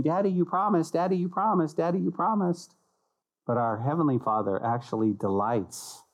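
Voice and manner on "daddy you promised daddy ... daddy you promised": put-on higher-pitched, repetitive kid's pestering; lightly voiced child badgering a parent; nagging child. Children come to Dad and keep saying it, nagging. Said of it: "our children come to us and kept saying".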